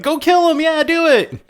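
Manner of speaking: very high, cheering killer doll; gleeful doll shout. Retold or imitated. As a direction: egg it on like a manic cheerleader. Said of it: "shout encouragement for the body".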